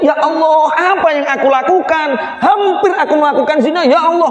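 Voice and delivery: high, pleading cry to God; pious man horrified at nearly sinning, crying out; anguished prayer. Acted. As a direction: beg God in panic. Pious man cries out in remorse.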